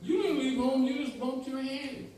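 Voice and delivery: put-on high, bright girl voice; Dorothy voice. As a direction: chirp it like a cheerful girl. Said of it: "And she was".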